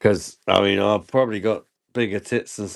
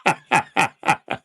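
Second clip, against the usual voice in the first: high laugh; 9 semitones higher than usual. Real, not acted. Laughs after the line.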